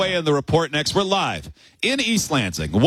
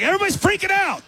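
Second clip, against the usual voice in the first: panicked rush; 13 semitones higher, faster than usual. Retold or imitated.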